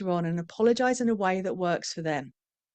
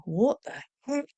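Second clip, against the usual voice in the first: put-on baffled child; 7 semitones higher, slower than usual.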